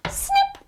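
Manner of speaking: a sharp, high snip of scissors; bright scissor snip; high snip sound. A narrator's reading. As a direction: chirp it like scissors closing. Scissors cut the ribbon.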